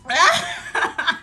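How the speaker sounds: breaking up laughing, stopping to recover; losing it, tears of laughter. Real, not acted. Vlogger cracking up while telling the story, laughing to tears. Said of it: "I'm tearing up".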